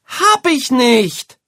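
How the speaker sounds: very high, loud, slow yell of denial; shouting boy. A narrator's reading. Boy yelling at his mother, furious denial. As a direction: scream the denial. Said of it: "schreit Jan Arne".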